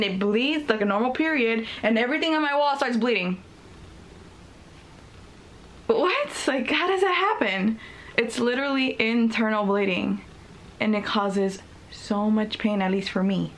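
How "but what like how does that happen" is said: sharp high disbelieving "what"; baffled, pitch leaping up; surprised. Real, not acted. Disbelief at her own story.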